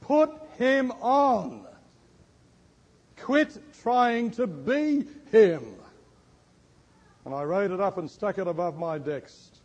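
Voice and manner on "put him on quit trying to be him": high, slow, forceful preaching; God's command, preached slow and high; emphatic command. Retold or imitated. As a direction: punch out each word slowly, pitched high.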